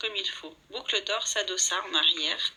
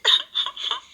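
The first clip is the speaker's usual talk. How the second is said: put-on Baby Bear bursting into tears; 6 dB louder than usual. A narrator's reading. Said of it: "Il fondit en larmes".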